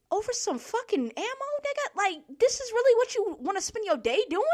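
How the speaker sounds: how is this your day, really; incredulous outburst. Retold or imitated. Cashier to the customer refusing ID, incredulous outburst.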